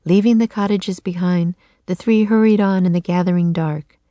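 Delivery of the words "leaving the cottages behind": usual speaking voice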